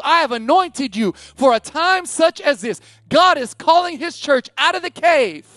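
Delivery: high, loud full-force preaching; yelling. Real, not acted. Pastor yelling God's call to leave the cave, loud preaching. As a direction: preach it out hard at them. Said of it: "I know I'm yelling".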